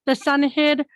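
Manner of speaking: lilting verse, phrase by phrase; poem recital. Acted. Poet reading the same poem in reverse, lilting recital. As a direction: recite it with a measured poetic lilt.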